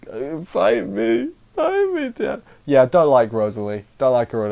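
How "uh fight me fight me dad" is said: high, slow wailing plea through mock tears; mock tearful whine. Daughter wails at her dad in mock tears, high and slow. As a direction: whimper it like a sulking daughter. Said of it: "she's in tears".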